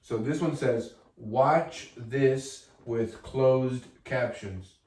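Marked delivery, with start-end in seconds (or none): 1.2-4.7 slow read-out. Shown as a demonstration. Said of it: "When I wrote this".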